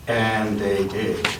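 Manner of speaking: ordinary delivery, nothing marked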